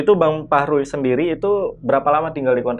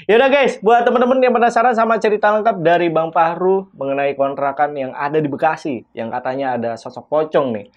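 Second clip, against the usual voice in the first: higher, louder announcer voice; show host outro; 5 semitones higher, 4 dB louder than usual. Acted. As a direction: wrap up with hype. Host closes the intro.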